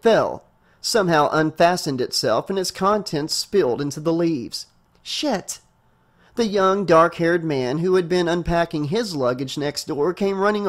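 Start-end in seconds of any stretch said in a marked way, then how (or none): 5.0-5.6 sharp high exclamation; startled curse. Acted. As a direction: blurt it out in surprise. Suitcase spills, she curses.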